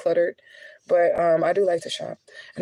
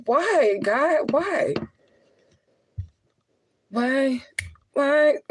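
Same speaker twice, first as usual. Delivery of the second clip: higher, imploring cries of why; mock-anguished plea to God, pitched up; pleading to God; 7 semitones higher, much slower than usual. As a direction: beg the heavens for an answer. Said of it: "I be like".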